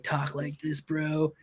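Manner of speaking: a light, laid-back surfer bro impression; lazy beach-dude drawl, tongue in cheek. Guest mimicking his mentor's mentor, a mild surfer dude. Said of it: "like, a California surfer dude".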